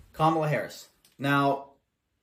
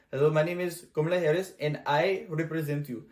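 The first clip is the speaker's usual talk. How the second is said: mock Indian accent; lightly done Indian accent impression. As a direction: parody of a politician in an Indian accent. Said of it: "you had an Indian accent".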